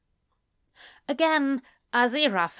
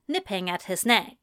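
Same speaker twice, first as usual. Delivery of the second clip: usual speaking voice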